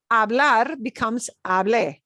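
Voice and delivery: hablé with the last syllable punched; teacher hits the é of hablé hard; stress on the final e. Shown as a demonstration. Spanish teacher to class, punching the final é. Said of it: "we punch that e sound at the end".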